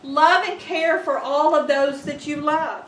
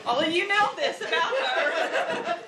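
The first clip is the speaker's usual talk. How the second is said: laughing; 2 semitones higher than usual. Real, not acted. Laughing during tribute list.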